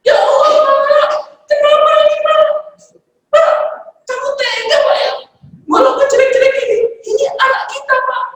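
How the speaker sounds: very high, shrill-pitched wailing plea; mother screaming at her husband over the bleeding child; hysterical mother. Acted. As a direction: cry out in panic at the husband. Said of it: "langsung teriak histeris".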